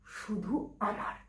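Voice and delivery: slow, soft claim; slow right down, softly possessive; possessive murmur. Acted. Jealous bride-to-be claims him as hers, slow and soft.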